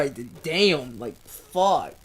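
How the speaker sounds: low, deflated tearful cursing; starting to cry. Real, not acted. Reader starting to cry at the page, low and tearful. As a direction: let the voice sink as real tears come. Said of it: "I'm starting to cry".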